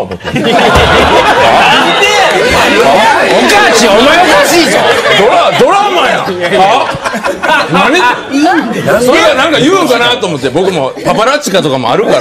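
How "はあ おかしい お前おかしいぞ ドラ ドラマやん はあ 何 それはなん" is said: high, loud laughing disbelief; incredulous outburst. Retold or imitated. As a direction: cry out in amused outrage. Retold disbelief at brother-in-law.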